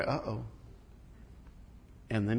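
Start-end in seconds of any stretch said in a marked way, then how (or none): 0.0-0.4 1 sheepish uh-oh. Retold or imitated. Disciple realizing his mistake.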